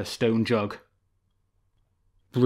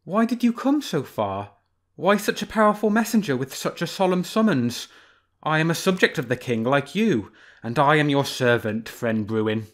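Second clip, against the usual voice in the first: sly fox; 4 semitones higher than usual. Acted. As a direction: play the fox, teasing the bear.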